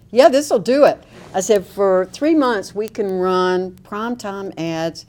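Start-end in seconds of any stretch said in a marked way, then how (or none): 0.1-1.0 a loud, pitched-up burst of glee at the idea; a sudden eureka, much louder and higher; excited realization. Retold or imitated. To the governor's aide, gleefully hitting on the plan: loud excited burst. 1.7-5.1 slow, weighed words, each one set down; slow deliberate warning. Retold or imitated. To the governor's aide, spelling out the ad campaign: slow, deliberate warning.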